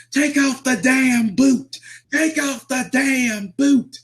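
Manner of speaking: very high, slow, stretched-out screech; imitated top-of-lungs screaming. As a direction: scream each word in mock agony. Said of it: "screaming at the top of his lungs".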